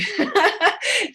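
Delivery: high-pitched real laugh. Real, not acted. Host joking that she won't go on and on, laughing.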